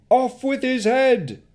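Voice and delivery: high, loud, slow cry; mob shout. Acted. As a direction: roar for his head. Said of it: "the crowd shouted".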